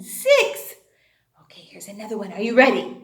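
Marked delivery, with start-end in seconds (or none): 1.4-2.3 hushed whisper. Acted. Teacher whispers to build suspense before the word.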